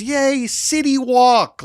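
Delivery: high, drawn-out cheer imitating a little daughter; excited exclamation. Dad imitates his daughter arriving at City Walk, excited exclamation. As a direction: cheer it like a delighted kid, stretching the words. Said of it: "she will exclaim that".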